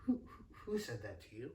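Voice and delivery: soft, halting mom voice; mom's stunned question. Retold or imitated. Mom asking her son who insulted him, hushed and halting. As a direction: stammer the first word quietly, with pauses. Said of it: "And she goes".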